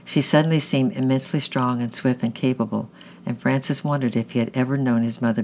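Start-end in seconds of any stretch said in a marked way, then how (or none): none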